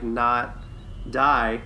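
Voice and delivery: normal talking voice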